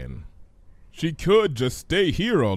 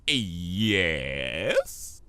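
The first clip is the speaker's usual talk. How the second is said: sly villain voice; 7 semitones lower than usual. Acted. Discord voiced in story.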